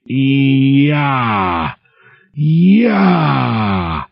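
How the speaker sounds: high inhuman scream; blood-curdling scream. Acted. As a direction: shriek it out in terror. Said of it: "blood-curdling scream".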